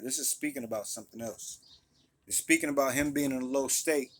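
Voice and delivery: usual speaking voice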